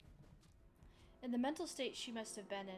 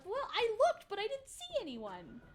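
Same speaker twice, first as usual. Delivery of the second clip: defensive protest; 12 semitones higher, 10 dB louder than usual. Retold or imitated. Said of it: "I was like".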